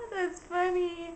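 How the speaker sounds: high-pitched, forced mock laugh; uncomfortable laugh. Woman brushing off a creepy compliment, laughing uncomfortably. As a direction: imitate laughing off an awkward compliment in a squeaky high voice. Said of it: "very uncomfortable".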